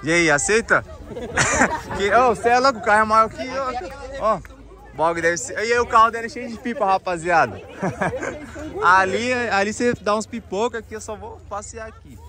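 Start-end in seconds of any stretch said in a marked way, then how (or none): 0.9-1.9 amused laugh. Real, not acted. Host laughs after daring his guest to swap cars, amused laugh. 7.7-8.8 short breathy chuckle; amused laugh. Real, not acted. Host jokes that the car is full of kites, amused chuckle.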